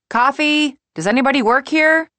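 loud, high call for service. Acted. Molly standing up for coffee, calling out impatiently. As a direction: holler across the room, quickening.

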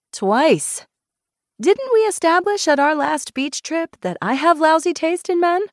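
high-pitched, unabashed boast; proud of it. A narrator's reading. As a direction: own up with showy pride. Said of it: "you don't have to sound so proud of it".

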